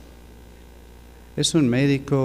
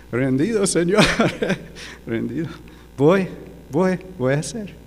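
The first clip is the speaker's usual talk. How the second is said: higher-pitched mock surrender with laughter; laughing surrender; 7 semitones higher than usual. Son-in-law yielding to God's call, laughing in surrender. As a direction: give in eagerly, laughing between words.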